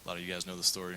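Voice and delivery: usual speaking voice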